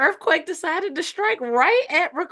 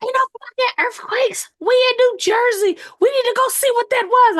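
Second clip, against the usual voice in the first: high-pitched mock outrage; imitated cussing out; 12 semitones higher than usual. Retelling coworker's denial of the quake, high mock outrage. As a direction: play the indignant coworker, pitched way up. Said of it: "she cussed me out so bad".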